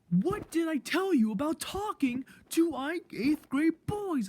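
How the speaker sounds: high, loud mock scolding; imitated angry teacher. Teacher catching a student talking to older boys, mock scolding. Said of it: "and then she's like".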